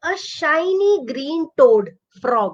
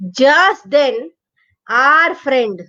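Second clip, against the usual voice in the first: plain reading voice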